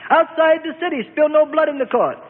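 sustained high shouted command; Annas shouting. A narrator's reading. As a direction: shout the order at the crowd, high-pitched. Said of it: "Annas shouted".

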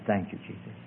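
very soft, low prompt; barely voiced, feed the words gently; imitating a whisper. Preacher prompting a praying girl, whispered. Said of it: "I began to whisper in her ear".

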